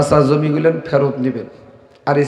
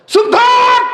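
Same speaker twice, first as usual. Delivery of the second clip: angry shout; 4 dB louder, slower than usual. Retold or imitated. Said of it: "রাগে রাগান্বিত হয়ে বলতেছেন".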